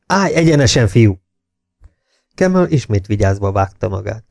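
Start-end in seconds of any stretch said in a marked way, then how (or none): 0.1-1.2 raised, barked order; narrated bellow. A narrator's reading. Jakes orders the prisoner to stand straight, moderately bellowed. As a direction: bark it high, short of a full shout. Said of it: "üvöltötte Jakes".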